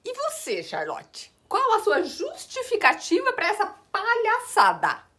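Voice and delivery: high, loud, slow indignant demand; outraged boss. Acted. Boss scolding Charlotte.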